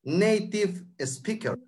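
Native speaker stretched slowly; slow clear repeat. Shown as a demonstration. Teacher repeats for the host, slowly and clearly. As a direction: spell it out for the host. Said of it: "¿Cómo se llamaba esa persona?".

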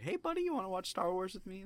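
put-on sweet high baby talk to a cat. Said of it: "jokingly talking to my cat".

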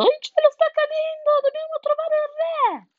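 very high, loud panicked shriek; yelling breathlessly. A narrator's reading. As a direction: squeal the alarm at full tilt. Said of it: "urlando a perdifiato". (urlando a perdifiato)

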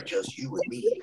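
high, soft creepy coo; hushed high come-on. Retold or imitated.

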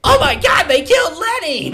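high, loud shocked cry; shocked shout. Pretend. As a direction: shout it in horror. Quoting South Park line.

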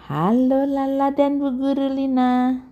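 high put-on voice for a children's tale; storybook greeting. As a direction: answer in a high singsong greeting. Story friends greet Lala and teacher.

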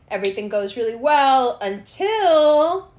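normal talking voice

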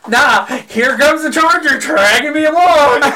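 high, drawn-out mock wail; retold outcry. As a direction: imitate a friend protesting as he is dragged. Friend's retold outcry as he's dragged.

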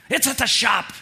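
snapped reply, high and loud; snapping. Retold or imitated. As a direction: bite the answer off at your daughter. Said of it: "I snapped".

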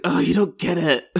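hammed-up frustrated complaint; mock frustration. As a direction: play at being fed up with a parent. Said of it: "would have been more frustrated".

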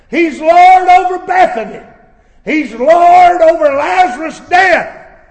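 loud, high preaching cry; preaching at full force. Acted. Preacher crying out to the congregation, loud and high. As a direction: thunder it out to the congregation.

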